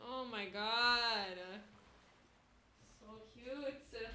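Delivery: ordinary talk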